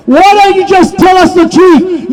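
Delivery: ordinary talk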